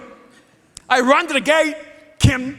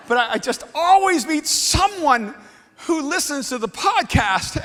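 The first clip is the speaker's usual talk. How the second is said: usual speaking voice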